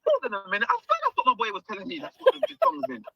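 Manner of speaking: excited realisation. Retold or imitated. Recognising the movie poster.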